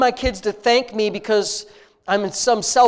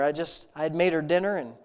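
ordinary delivery

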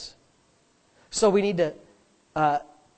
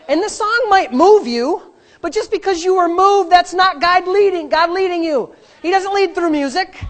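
high, loud, insistent preaching; impassioned preaching; 8 semitones higher, 7 dB louder than usual. Real, not acted. Preacher warning church not to follow feelings, impassioned preaching. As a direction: drive each point home to the congregation. Preacher builds at full force.

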